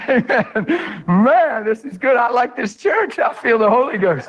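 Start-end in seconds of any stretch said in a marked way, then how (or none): none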